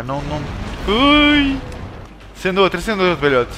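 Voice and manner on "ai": high, startled "ai"; sharp high-pitched cry of alarm; startled yelp. Real, not acted. Startled yelp during zombie attack.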